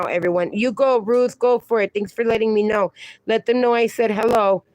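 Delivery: normal talking voice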